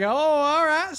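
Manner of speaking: loud high Southern "oh, all right"; Southern drawl. Retold or imitated. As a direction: drawl it like a good ol' boy. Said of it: "Then I'm like".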